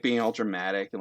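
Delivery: ordinary talk, nothing marked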